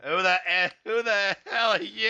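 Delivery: put-on high, hammy New Jersey accent; mock Jersey tough guy accent. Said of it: "you gotta talk like this from Jersey Shore".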